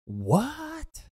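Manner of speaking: high shocked squeak of disbelief; surprised. Real, not acted. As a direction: blurt it out in shock. Shocked at dragon story.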